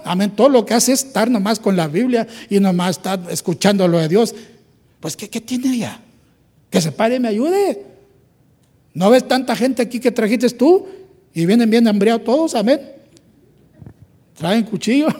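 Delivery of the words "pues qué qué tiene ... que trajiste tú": mock exasperated. Marta demanding Jesus send María to help, exasperated. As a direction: point at the lazy sister. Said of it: "esta mujer floja".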